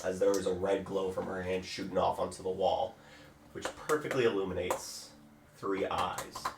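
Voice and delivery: game master scene narration. Acted. GM setting the scene for players, hushed narration. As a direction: draw the players into the moment.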